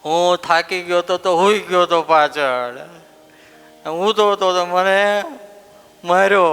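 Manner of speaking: a hint of a sniffling little boy complaining; whining child. Boy complaining to his mother about the teacher. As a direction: whimper it like a hurt child.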